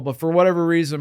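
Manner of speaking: usual speaking voice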